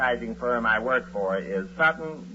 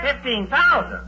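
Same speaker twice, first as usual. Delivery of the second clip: can't believe the number he just heard; stunned disbelief; 11 semitones higher, 6 dB louder than usual. Acted. Husband to brother-in-law, a high, loud incredulous yelp.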